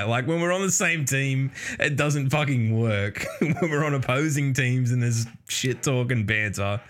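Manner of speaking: normal talking voice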